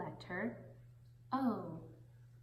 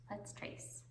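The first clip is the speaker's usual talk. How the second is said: whispered; 11 dB quieter than usual. Acted. Softly leading tracing.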